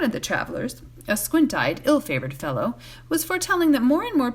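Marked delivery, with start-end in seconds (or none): none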